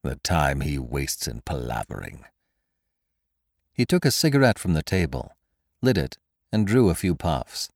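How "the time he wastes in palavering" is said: soft, low grumble; muttering. A narrator's reading. Kesselbach grumbling about his dawdling secretary, muttering. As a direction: mutter the complaint to yourself. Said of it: "muttered Kesselbach".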